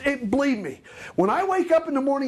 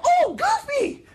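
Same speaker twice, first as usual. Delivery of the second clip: high, slow delighted squeal; excited fan; much slower than usual. Pretend. Guest at the Disney gate greeting Goofy, high delighted squeal. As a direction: gush like a kid seeing Goofy.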